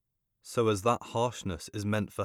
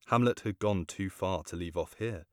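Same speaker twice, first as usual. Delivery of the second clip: ordinary delivery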